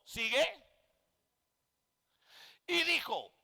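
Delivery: normal talking voice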